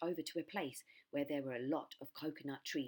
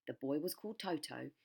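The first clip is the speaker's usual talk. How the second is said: usual speaking voice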